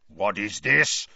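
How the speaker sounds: slow, menacing growled demand; angry growl. A narrator's reading. Uncle Vernon rounding on his nephew, growling slowly. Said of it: "growled Uncle Vernon".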